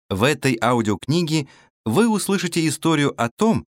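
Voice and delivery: ordinary delivery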